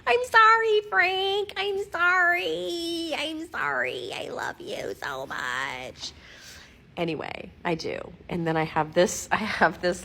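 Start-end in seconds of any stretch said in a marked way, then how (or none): 0.1-6.1 very high, drawn-out pet-talk apology; gushing apology. Pretend. Owner apologizing to her pug Frank, high gushing pet voice.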